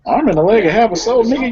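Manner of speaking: high, fast teasing repeat; incredulous echo. Real, not acted. Friend echoing the host's dramatic line, teasing and incredulous. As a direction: throw his words back in mock disbelief.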